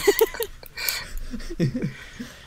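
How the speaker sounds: laughing hard and high; high burst of laughter. Real, not acted. Cracks up at the singing.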